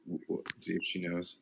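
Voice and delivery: normal talking voice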